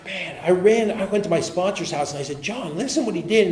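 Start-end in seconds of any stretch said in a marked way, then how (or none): 2.4-3.5 higher, urgent retold complaint; retold agitation. Upset man running to his sponsor to complain, acted agitation. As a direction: lightly act out an upset tattletale.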